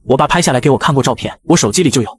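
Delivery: usual speaking voice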